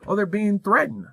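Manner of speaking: lightly hammed-up excuse-maker; mocking complainer. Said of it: "somebody's saying".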